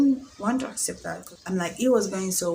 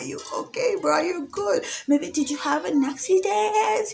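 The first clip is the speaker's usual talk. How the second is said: much higher, giggling through fake panic; laughing mock worry; 9 semitones higher, 3 dB louder than usual. Imagining he had an accident, laughing at her own worry. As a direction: squeak the worry out while laughing. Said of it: "So I'm always like".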